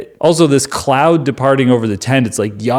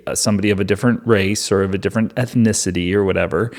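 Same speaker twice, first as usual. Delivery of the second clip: normal talking voice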